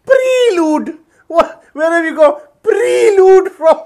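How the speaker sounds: mocking imitation. Mocking the rival scholar's pronunciation, high and loud. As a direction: ham up the rival's "Prelude" high and slow. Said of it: "The whole world is gonna laugh at you".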